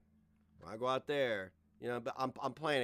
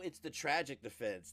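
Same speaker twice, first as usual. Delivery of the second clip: normal talking voice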